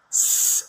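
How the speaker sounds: ஸ் as a long voiceless hiss; sustained snake-like ஸ்; S sound demo. Shown as a demonstration. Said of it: "Like snake sound கொடுக்கும்".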